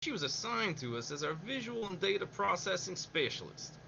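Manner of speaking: put-on briefing voice in a wavering accent; Colonel in a slipping accent. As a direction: Colonel introducing Mei Ling, accent drifting. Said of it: "Slipping in and out".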